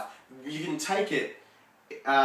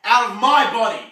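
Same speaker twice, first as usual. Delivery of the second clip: loud, higher banishing cry; forceful command; 5 semitones higher, 8 dB louder than usual. Acted. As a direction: order it out with full force. Acting out casting Satan out.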